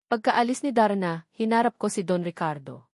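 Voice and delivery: ordinary delivery, nothing marked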